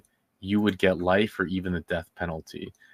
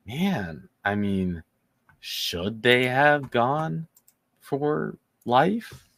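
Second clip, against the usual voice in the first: higher, slow questioning voice; hypothetical viewer; 4 semitones higher, much slower than usual. Pretend. Host voices a viewer questioning the plea deal. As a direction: voice a doubtful viewer asking. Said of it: "you might say".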